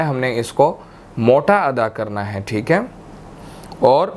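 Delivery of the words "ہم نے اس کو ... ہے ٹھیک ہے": ordinary talk, nothing marked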